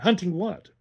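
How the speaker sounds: put-on the boy presses her, keen to know; excited. A narrator's reading. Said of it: "with excitement".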